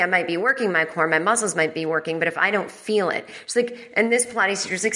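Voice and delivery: usual speaking voice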